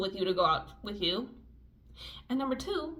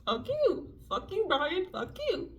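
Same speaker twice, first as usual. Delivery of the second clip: high, mocking fuck yous; retold high-pitched mock fury; mock angry retort; 7 semitones higher, slower than usual. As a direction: jab the words at him in mock anger. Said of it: "I got his ass".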